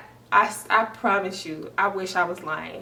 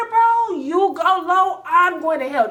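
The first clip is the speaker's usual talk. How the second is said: higher, louder, drawn out punchline; heated; 9 semitones higher, 5 dB louder than usual. Real, not acted. Telling viewers she retaliates, loud emphatic. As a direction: hit the catchphrase hard. Heated rant about revenge.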